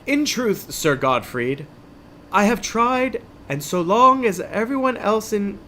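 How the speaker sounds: high-pitched English knight; English-accented knight. Acted. Knight answering his companion about the riddle, English accent. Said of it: "an English accent and the other one doesn't".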